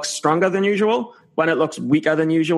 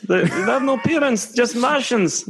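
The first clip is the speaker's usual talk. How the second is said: a hammed-up Scottish accent, pitched higher; terrible Scottish accent; 6 semitones higher than usual. Joking about Martians, a bad Scottish accent. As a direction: joke Scottish Martian voice, badly faked. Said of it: "that's a terrible accent".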